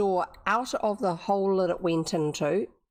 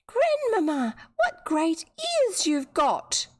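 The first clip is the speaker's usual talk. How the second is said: put-on higher, slow wide-eyed little girl; 9 semitones higher, much slower than usual. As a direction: sound small and amazed. Girl's voice, much higher.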